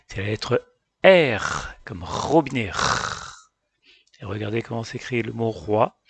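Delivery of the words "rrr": R as a long unvoiced rrr; letter sound R. Shown as a demonstration. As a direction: hold R as throat friction. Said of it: "qui se prononce R". (R prononcé « rrr », sans voyelle)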